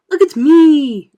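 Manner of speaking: high, loud, slow cry; excited cry. Acted. Centipede showing off, loud excited cry. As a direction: crow it out. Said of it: "cried the centipede".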